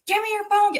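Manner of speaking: angry demand. Retold or imitated. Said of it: "and he's just like".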